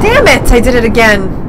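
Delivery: loud, high angry outburst; frustrated shout. Real, not acted. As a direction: yell it in frustration. Frustrated gamer shouting at game.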